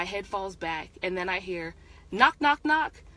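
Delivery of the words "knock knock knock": loud, high, urgent knock-knock; sudden knocking. A narrator's reading. Interrupting the lovers at her office door, loud sudden knocking. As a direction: rap the words out high and loud.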